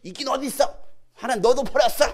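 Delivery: high, loud jeering yell; yelled jab. Retold or imitated. Bystander to someone praying, yelled jeer. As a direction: fire it off as a mocking shout. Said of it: "한번 지르잖아".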